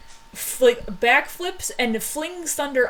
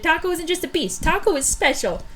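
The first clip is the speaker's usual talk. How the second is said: indignant protest; 8 semitones higher than usual. Acted. Defending Taco the pet beast, high and indignant. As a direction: stick up for a beloved pet, pitched up.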